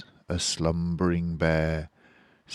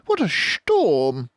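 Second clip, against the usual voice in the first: higher, slow, louder bird voice; twittering wren; 10 semitones higher, 5 dB louder than usual. Acted. Wren exclaims about the storm, twittering. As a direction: chirp it out like a little bird. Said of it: "twitters wren".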